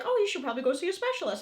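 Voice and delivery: mimicking the doctor. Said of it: "when they're like".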